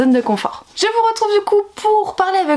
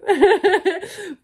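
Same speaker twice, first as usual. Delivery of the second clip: rire aigu et bouleversé; éclater de rire, submergée; rire ému; 7 semitones higher than usual. Real, not acted. Overwhelmed laughter. (rire nerveux ému)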